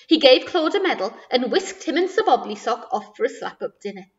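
ordinary delivery

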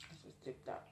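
whispered aside to herself; whispered, under the breath; whispering. Real, not acted. Eating, aside to self.

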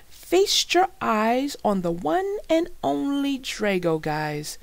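loud, high showman's boast; bragging. A narrator's reading. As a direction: show it off proudly to the crowd. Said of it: "Dan bragged".

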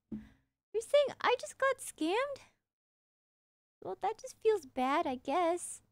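high, slow put-upon whine; mock wounded. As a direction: whine like you've been wronged, slow and high. Mock hurt at getting scammed.

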